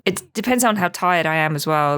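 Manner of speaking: ordinary talk, nothing marked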